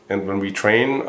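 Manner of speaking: ordinary talk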